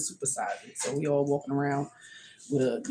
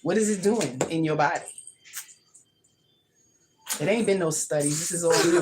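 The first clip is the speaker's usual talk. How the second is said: ordinary talk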